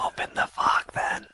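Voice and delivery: whispered retort to the fog; whispering back. Acted. Player answers the whispers in whisper.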